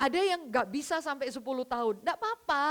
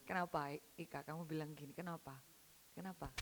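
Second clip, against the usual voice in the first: quiet questioning; 8 semitones lower, 14 dB quieter than usual. Real, not acted. Asks congregation, very quiet.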